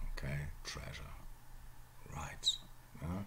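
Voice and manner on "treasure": TREASURE whispered as "TREZH-uh"; modern English. Shown as a demonstration. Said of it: "modern English".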